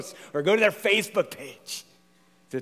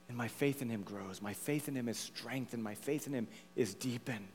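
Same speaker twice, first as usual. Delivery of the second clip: soft, intimate testimony; hushed devotion; 3 semitones lower, 11 dB quieter than usual. Real, not acted. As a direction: confide it quietly, phrase by phrase. Hushed personal testimony of faith.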